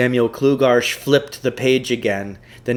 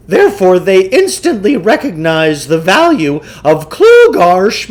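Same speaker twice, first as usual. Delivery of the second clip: higher, brighter voice of a smooth salesman guru; pompous huckster lecturing his marks; Samuel Klugarsh voice; 8 semitones higher, 8 dB louder than usual. Acted. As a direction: play the self-assured mind-control huckster. Klugarsh continues.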